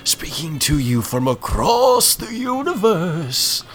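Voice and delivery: high, slow, booming spirit voice; echoing ethereal energy being. Retold or imitated. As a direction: call out from across the universe. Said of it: "so much of a better, like, spirit channel voice".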